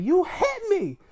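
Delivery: high-pitched mock accusation; put-on falsetto complaint; mock squabbling wrestler. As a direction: squeal it like an indignant wrestler, played for laughs. Acted ring argument between wrestlers.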